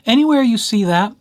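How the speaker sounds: ordinary delivery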